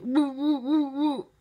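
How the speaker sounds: high, loud hooting woo woo of a fat dog; imitating a wheezy bark. Teller mimics Jim's fat dog trying to bark, high loud hoots. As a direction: hoot it out like a dog that can't bark. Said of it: "like this".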